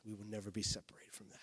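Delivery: hushed, fast whispered promise; intense whisper. Real, not acted. Pastor assuring the church, whispering. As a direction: lean in and whisper it. Closing line of a scripture point.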